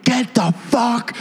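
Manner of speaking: slow, high-pitched mock yell; hammed-up angry lady. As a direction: yell it slowly as the furious redhead. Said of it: "it's that redheaded lady".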